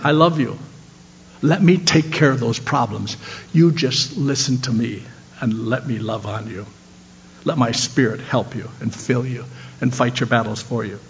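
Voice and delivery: soft, low, tender voice of God; hushed and reassuring, like a loving father; softly voiced, not a true whisper; gentle whisper. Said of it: "he whispers to us today too".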